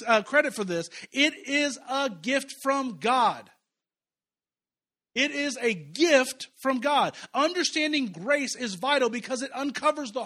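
slow, loud emphatic preaching; drawn-out pulpit proclamation. Acted. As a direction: hammer each word home.

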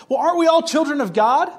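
mock challenging skeptic.

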